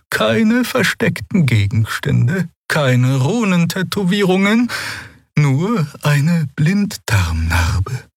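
put-on very high, slow, otherworldly old healer; old Draxyl healer. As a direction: speak as the ancient gentle alien doctor. Xea berichtet. (hohe, langsame, fremdartige Stimme einer alten Heilerin)

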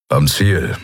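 low grumbling answer from the pilot's seat; gruff grumble. A narrator's reading. Pilot Kero announcing their arrival, low grumble. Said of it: "brummte Kero vom Steuer aus".

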